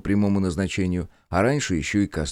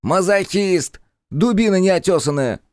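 high, loud angry yell; angry shout; 12 semitones higher, 5 dB louder than usual. Acted. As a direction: yell the correction in anger. Jester shouts angrily.

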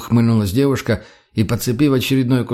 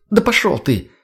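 high, exasperated send-off; heated brush-off; angry dismissal; 8 semitones higher than usual. A narrator's reading. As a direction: tell them off and walk away. Storms off from the quarrel.

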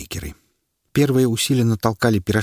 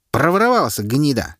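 heckler's shout; 6 semitones higher than usual. A narrator's reading. A second onlooker yells an insult at the minister. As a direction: spit it at the prisoner.

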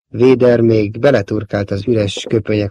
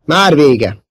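higher, louder call; urgent shout; 5 semitones higher, 5 dB louder than usual. A narrator's reading. Leila calling Luke back from the guards, shouting. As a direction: cry it out to someone far away. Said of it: "kiáltotta Leila".

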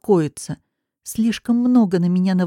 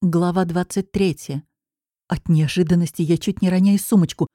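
usual speaking voice

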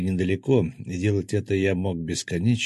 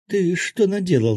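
high-pitched, louder outcry; shouted; 11 semitones higher, 6 dB louder than usual. A narrator's reading. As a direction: cry out in sharp reproach. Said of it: "закричала Кристина".

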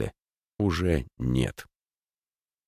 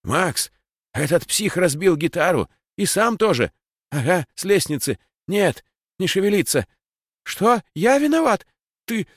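much higher, voice breaking in terror; a terrified boy, close to sobbing; panicked, near tears; 11 semitones higher, 3 dB louder than usual. Acted. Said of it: "хриплый, срывающийся от ужаса".

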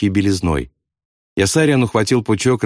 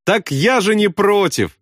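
high, drawn-out happy cry; triumphant high shout at moderate volume; joyful yell; 12 semitones higher than usual. A narrator's reading. Pilot gladly gives in, joyful yell. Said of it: "радостно заорал он".

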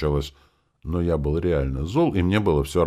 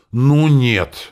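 exasperated outburst; 8 semitones higher, 9 dB louder than usual. Acted. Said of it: "не выдержал я".

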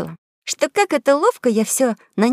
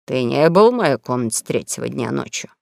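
stern king voice; 7 semitones lower than usual. Acted. King questions Huck.

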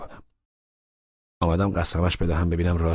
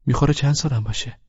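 put-on higher young woman's voice, fishing for a compliment; 8 semitones higher than usual. A narrator's reading. Laleh fishing for a compliment about her age, pitched up and playful. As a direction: tease him into guessing young. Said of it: "ادامه داد".

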